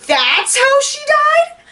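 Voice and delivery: high, drawn-out incredulous question; retold shock. Retelling her reaction to the death, shocked disbelief. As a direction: let disbelief climb to the end. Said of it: "That's why I went into shock".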